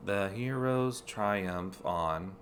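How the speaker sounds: slow, counted syllables; haiku reading. Shown as a demonstration. Player reading game text as a haiku, slow and syllable by syllable. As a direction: read "The hero's triumph on" as a haiku, counting each syllable slowly. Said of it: "Is that a haiku?".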